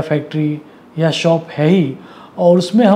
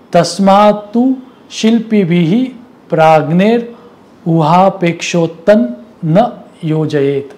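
declaimed Sanskrit verse with deliberate breaks; Sanskrit verse recitation; 2 semitones higher, 4 dB louder than usual. Acted. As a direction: recite a scriptural warning in measured phrases.

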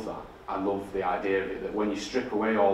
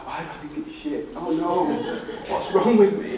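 introspective movie star; 8 semitones higher than usual. Retold or imitated. Doubter questioning own taste at work, hammed-up introspective film star. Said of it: "really kind of introspective, you know, like movie star".

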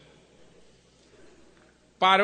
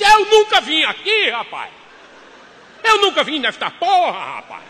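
furious yell; 9 semitones higher, 9 dB louder than usual. Retold or imitated. Husband to wife after banging the table, furious yell. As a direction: bellow the denial.

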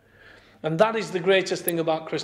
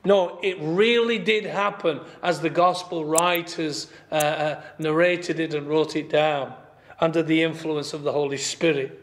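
usual speaking voice

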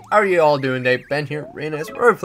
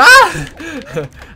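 high loud burst of laughter; 12 dB louder than usual. Real, not acted. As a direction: let out a big laugh. Laughs after the near miss.

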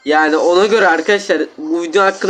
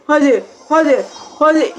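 each hadi higher and more eager; building excitement; 6 semitones higher than usual. Real, not acted. Gamer urging a prize box open, rising eager chant. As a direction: climb higher with every hadi.

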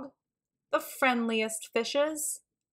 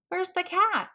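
higher calling voice, looking for the cat; 10 semitones higher than usual. A narrator's reading. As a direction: call out for the missing cat. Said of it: "Archie called".

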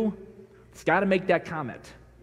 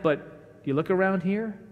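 normal talking voice

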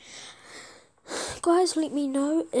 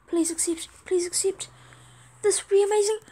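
urgent plea, climbing higher; eager pleading; 6 semitones higher, 5 dB louder, faster than usual. Real, not acted. Player waits on a trade being accepted, eager pleading. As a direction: beg with mounting excitement, getting louder.

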